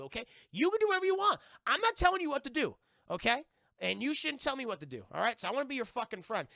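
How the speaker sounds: builds from firm to a high, loud yell; angry rant. Acted. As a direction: tell him off until you're shouting. M T snaps at Jesse.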